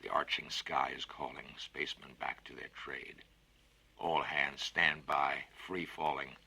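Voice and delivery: slow, solemn recited verse; poem recital. Acted. Narrator reciting the dying spaceman's song, slow and solemn.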